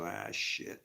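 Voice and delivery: low, soft rueful curse; resigned mutter. Retold or imitated. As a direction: sigh it out. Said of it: "I just go".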